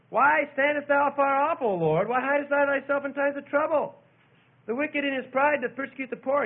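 loud, high pleading recitation; crying out. Acted. David questioning God in trouble, crying out loudly. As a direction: call out the complaint to God. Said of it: "he's crying out to God".

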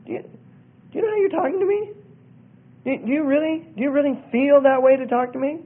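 high-pitched, halting appeal to stop and think; gently confronting. Acted. Acting out interrupting an argument.

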